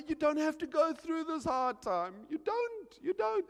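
high, urgent begging; pleading. Acted. Pastor to struggling member about hard times, high pleading.

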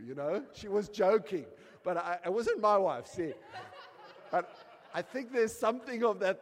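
loud, high, driving sermon delivery; fiery preaching. Acted. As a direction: preach it out with full force. Preaching at full force.

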